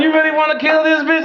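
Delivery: high piping voice of the Lord; the Lord's voice. Retold or imitated. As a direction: play God as a squeaky questioner. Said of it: "He said".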